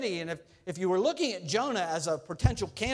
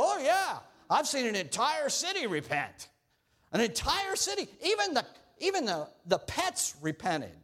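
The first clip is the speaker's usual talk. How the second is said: much higher, excited bragging; boastful Jonah; 7 semitones higher than usual. Acted. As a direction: crow about the whole city repenting. Jonah boasts a city repented.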